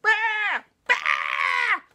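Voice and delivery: high, loud frightened bleats; crying out. Acted. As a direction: bleat for help as loud as you can. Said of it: "And Oscar cried out".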